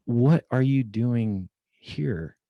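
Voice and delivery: slow, stretched-out disbelief; amazed question. Retold or imitated. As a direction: drag it out, still reeling. Amazed she's in Boise.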